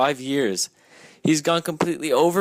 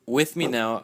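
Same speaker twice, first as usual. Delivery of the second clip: usual speaking voice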